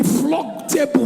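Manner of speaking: ordinary delivery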